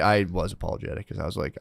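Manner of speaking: usual speaking voice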